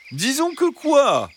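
high, slow, sing-song fox voice; drawn-out, prodding high fox; insistent fox. A narrator's reading. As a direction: press sweetly for an answer. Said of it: "insista le renard".

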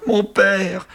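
high, emotional outcry; hammed-up sob of recognition; tearful cry. A narrator's reading. Son crying out to his new-found father, high melodramatic tearful cry. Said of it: "il se mit à pleurer et s'écria".

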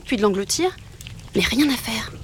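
usual speaking voice

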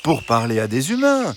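high, patient fox voice; reasoning fox. Acted. Fox arguing against La Fontaine, high and patient. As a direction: explain kindly as the fox. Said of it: "Le renard reprit".

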